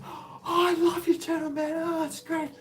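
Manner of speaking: high, gushing fan voice; young fan meeting him at the mall; imitating the fan. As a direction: gush like a starstruck young fan. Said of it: "He goes".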